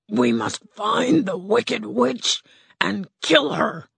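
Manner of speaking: lightly voiced timid lion; a hint of a nervous big cat; cowardly lion. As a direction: play the lion, a bit unsure. Lion speaks.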